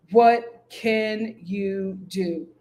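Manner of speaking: what can you do, stretched out slowly; slow resigned drawl. Real, not acted. Shrugging off Antonio's situation, slowly drawn out. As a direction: let it hang, slow and resigned.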